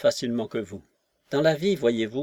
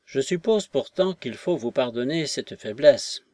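plain reading voice

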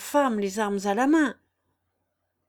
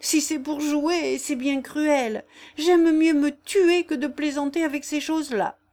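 higher and louder, slowing in fear; frightened voice; 7 semitones higher, 5 dB louder than usual. Acted. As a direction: plead shakily to be let go. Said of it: "la voix altérée de Jeanne".

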